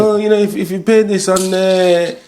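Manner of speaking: high, light mock-helpful clerk; breezy clerk voice. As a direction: imitate the casual council clerk. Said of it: "she's like".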